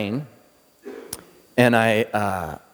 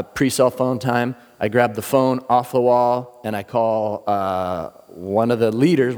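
ordinary talk, nothing marked

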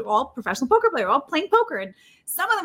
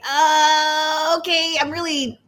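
retold fluster; 5 semitones higher, much slower than usual. Retelling freezing on camera.

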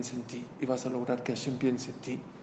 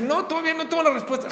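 fast, high brush-off from the rabbi; hurried rabbi; 5 dB louder, faster than usual. Acted. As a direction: rattle it off quickly at the door. Rabino abre la puerta apurado. (rabino apurado, rápido y agudo)

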